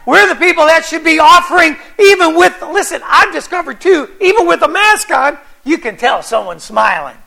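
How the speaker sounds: loud, high, ringing pulpit delivery; full-force preaching. Acted. Pastor rallies his congregation: loud, fervent preaching. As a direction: preach it out to the whole church.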